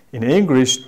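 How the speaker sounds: ordinary delivery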